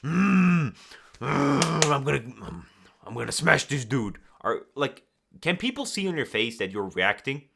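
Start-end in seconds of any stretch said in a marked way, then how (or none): 0.0-4.2 seething anger. Retold or imitated. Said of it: "going like this".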